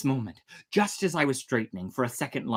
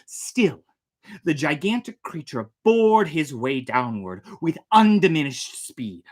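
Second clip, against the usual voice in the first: plain reading voice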